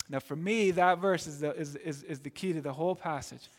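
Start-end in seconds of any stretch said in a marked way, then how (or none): none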